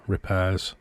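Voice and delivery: usual speaking voice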